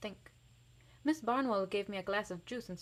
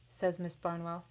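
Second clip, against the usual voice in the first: usual speaking voice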